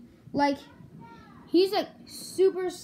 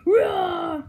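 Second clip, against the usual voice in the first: a louder, sharper practice Rah!; Rah! pushed louder the second try; louder jump scare; 6 dB louder than usual. Pretend. Plush practicing its jump scare, louder retry. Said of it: "No, I can do it scary".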